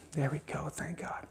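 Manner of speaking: whispered thanks under the breath; real relieved whisper. Real, not acted.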